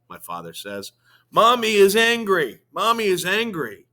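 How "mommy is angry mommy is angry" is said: high, loud anxious repeat; repeating child. A narrator's reading. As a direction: say it twice, child upset and loud. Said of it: "Natalie says".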